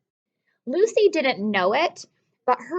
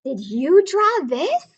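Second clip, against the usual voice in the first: curious question; 5 semitones higher, 5 dB louder than usual. A narrator's reading. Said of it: "Lucy asked Mom and Dad".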